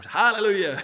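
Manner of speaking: imitating a crowd's loud, high Hallelujah; big acted cry of praise; congregation's jubilant shout. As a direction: burst out like a Pentecostal congregation. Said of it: "all the West Indian Pentecostals were".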